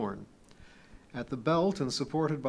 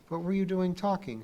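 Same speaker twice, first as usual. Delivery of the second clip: higher-pitched, reasonable question; 6 semitones higher than usual. A narrator's reading. Pee-wee answering Kip's scolding, reasonably. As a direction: ask it evenly, pitch raised. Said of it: "she asked reasonably".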